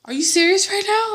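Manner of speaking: hammed-up shock; a bride acting out disbelief at guests; mock disbelief. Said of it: "I'm like".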